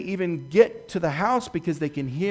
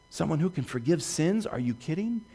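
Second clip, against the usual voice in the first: ordinary talk, nothing marked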